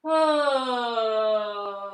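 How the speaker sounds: loud open-throated sighing ah; loud release sigh. Shown as a demonstration. Yoga teacher showing class a loud releasing sigh. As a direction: let a big loud sigh fall out. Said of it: "Make some noises to help your body relax".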